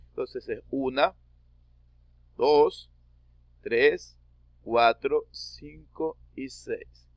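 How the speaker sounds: spaced counting, fading at the end; counting syllables. Shown as a demonstration. Teacher counts syllables aloud for students. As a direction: count each syllable out slowly. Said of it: "Se lee como si fuera una sola sílaba".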